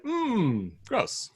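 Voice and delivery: high, drawn-out mock disgust. Viewer reacting to a gross film scene, high mock disgust. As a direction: recoil at the screen in mock disgust. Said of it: "anybody else was like".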